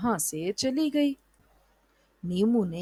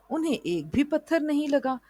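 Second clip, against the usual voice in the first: ordinary delivery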